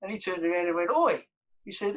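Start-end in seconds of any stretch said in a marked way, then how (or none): 0.9-1.2 loud high call of "Oi"; shouted oi. Retold or imitated. Manager calls to an unknown player, a loud high shout. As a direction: snap "Oi" across the bus.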